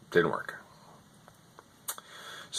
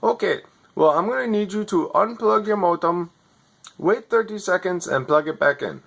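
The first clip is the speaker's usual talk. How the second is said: higher, scripted helpdesk patter; overseas tech support voice; 9 semitones higher than usual. Retold or imitated. Overseas agent reading his script to a customer, lightly mocking. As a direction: read the script like a bored call-centre agent. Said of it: "You're not even in America".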